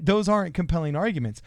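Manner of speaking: usual speaking voice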